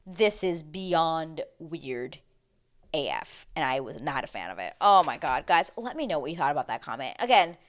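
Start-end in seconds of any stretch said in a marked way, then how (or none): 0.0-3.5 very slow, dragged disbelief; drawn out. Real, not acted. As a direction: stretch every word in shock. Stunned disbelief.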